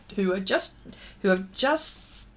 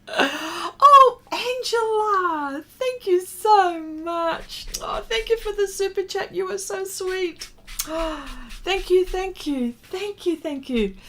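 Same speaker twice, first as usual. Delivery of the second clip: high, gushing thank-yous; overwhelmed with gratitude; thanking a viewer for a super chat, voice up high; 9 semitones higher than usual. Real, not acted.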